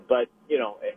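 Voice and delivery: ordinary talk, nothing marked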